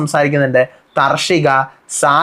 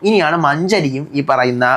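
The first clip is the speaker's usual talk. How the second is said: ordinary delivery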